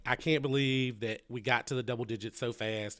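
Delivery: ordinary talk, nothing marked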